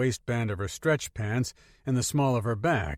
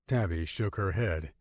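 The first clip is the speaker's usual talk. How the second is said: ordinary delivery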